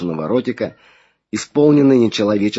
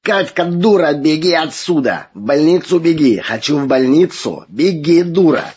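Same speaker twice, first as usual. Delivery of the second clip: high strained yell of an alien voice; frantic shouting hostage taker; 7 semitones higher, 4 dB louder than usual. Acted. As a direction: scream it out as a demon holding her hostage. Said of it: "Хриплый, мужской, чужой голос надрывно кричал".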